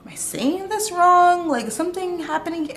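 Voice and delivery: high, slowed, unsure questioning; confused self-quote. Retold or imitated. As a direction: ask it as if baffled and doubting yourself. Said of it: "and I was like".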